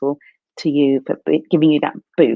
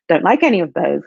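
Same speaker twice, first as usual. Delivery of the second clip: usual speaking voice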